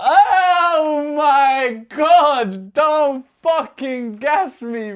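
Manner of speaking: high, loud, long-drawn cry of disbelief; real amazed outburst. Real, not acted. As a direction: stretch every word out in awe, loud and high. Thrilled at finding the original lyrics.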